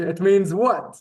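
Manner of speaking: rising question on what. Shown as a demonstration. Teacher modelling the question, it means what? lifted. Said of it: "That's what it means".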